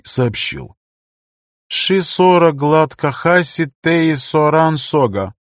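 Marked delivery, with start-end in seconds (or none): 1.7-5.3 higher, slow, velvety alien speech; seductive female voice. Acted. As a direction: purr the strange words slowly and seductively. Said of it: "приятный, бархатистый, соблазняющий".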